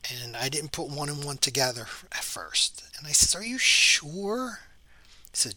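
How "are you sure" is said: doubtful. Retold or imitated. Joe doubting the ghost story.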